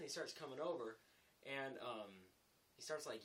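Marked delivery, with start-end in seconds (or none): none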